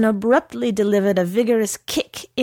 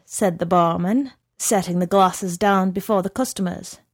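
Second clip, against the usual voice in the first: usual speaking voice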